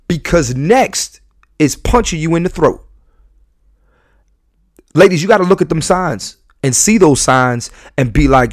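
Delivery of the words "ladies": ordinary talk, nothing marked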